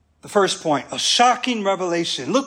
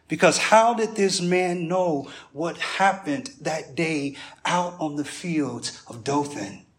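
normal talking voice

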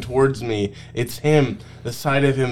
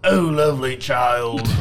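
Shrek attempt, failed. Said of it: "I can't do Shrek".

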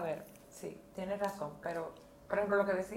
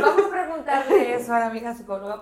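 loud, laughing invitation; 4 semitones higher, 13 dB louder than usual. Real, not acted. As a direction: laugh through the words. Laughing while talking.

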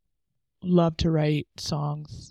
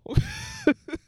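emotional laugh. Real, not acted. Emotional laugh after rereading.

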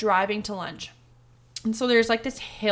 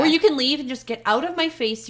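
raised, fast, heated retort; retold angry outburst; 5 semitones higher, 4 dB louder, faster than usual. Retelling telling William off, heated and quick. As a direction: fire it back at him, faster and louder.

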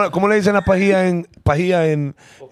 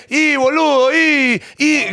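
put-on Argentine singsong accent; 7 semitones higher, much slower than usual. Arguing that Argentines have a lilt, mock Argentine accent. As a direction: imitate an Argentine's cantadito. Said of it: "que tiene un cantadito".

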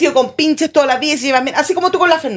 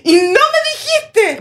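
high, slow, drawn-out mock reproach; angry friend's reproach; 10 semitones higher, much slower than usual. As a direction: whine it like a betrayed friend. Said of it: "esa persona después se enoja contigo". (reproche de amiga ofendida, agudo y lento)

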